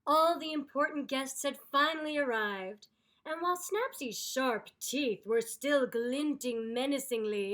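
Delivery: ordinary delivery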